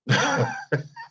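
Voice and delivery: quick high chuckle; short laugh. Real, not acted. Reacting to a clip.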